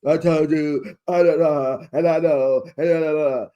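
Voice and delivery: loud babbling mock argument; mimicked yelling. Castmates shouting over each other, mimicked yelling. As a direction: yell over them in run-together bursts. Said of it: "you are the queen of yelling".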